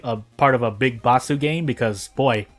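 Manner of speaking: normal talking voice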